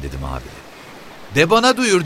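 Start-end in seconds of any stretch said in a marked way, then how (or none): 1.3-2.0 very high, challenging retort; bristling, high-pitched demand; defiant woman. A narrator's reading. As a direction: stand up to him in a shrill voice. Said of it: "diye diklendi kadın".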